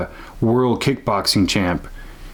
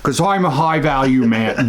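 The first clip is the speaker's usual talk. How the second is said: mock Tate boast; 4 semitones higher than usual. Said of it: "the way he talks is just like".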